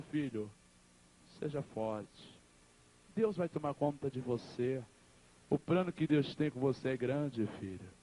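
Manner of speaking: low, slow comforting words; steady the son gently, pausing between phrases; acted dying mother.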